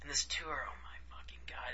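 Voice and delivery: whispered and exasperated; venting under his breath about the tour. Real, not acted. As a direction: hiss it under your breath in disbelief. Exasperated aside about long tour.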